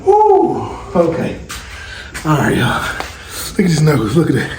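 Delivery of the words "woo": excited whoop. Real, not acted. Pumped up after hitting the bag, high whoop. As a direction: let out a whoop after the hits.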